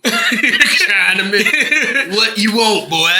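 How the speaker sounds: put-on slow, drawling country accent. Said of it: "the country one".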